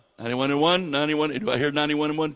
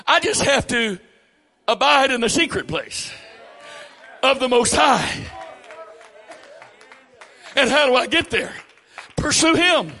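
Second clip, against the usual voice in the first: much higher and louder, building to shouted "Pursue Him"; full-force preaching; 11 semitones higher, 7 dB louder than usual. Real, not acted. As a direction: preach the climax at full force, hammering the phrase. Preaching climax.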